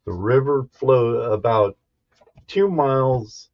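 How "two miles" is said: ordinary delivery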